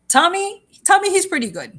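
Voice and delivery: ordinary talk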